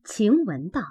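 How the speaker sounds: plain reading voice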